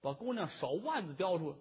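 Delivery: ordinary talk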